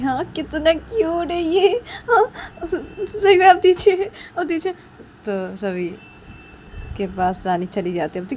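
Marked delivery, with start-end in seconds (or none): 0.0-4.7 gushing with a laugh. Acted. Rani gushes, begs for baby.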